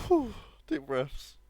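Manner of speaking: ordinary delivery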